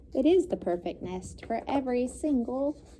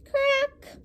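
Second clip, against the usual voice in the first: much higher sharp crack; egg cracking. A narrator's reading. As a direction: pop it out as the shell breaks.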